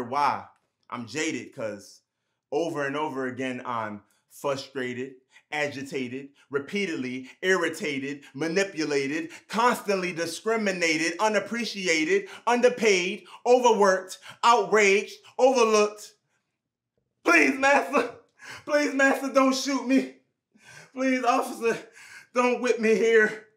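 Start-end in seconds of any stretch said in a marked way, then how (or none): 3.6-16.1 1 building to outrage. Acted. 17.2-23.5 1 desperate pleading. Acted. Pleading voice of a victim.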